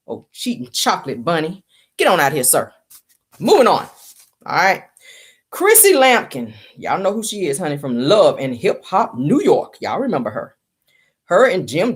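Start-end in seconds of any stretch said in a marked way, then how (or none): none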